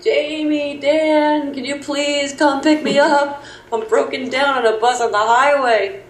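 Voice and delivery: mock pleading. Acted pleading phone call for help.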